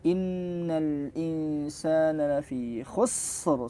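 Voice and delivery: "khusr" dibaca pelan dengan ra ditekan seperti tasydid; very slow recitation stressing the ra; slow Quranic recitation. Shown as a demonstration. (bacaan lambat, ra seakan bertasydid)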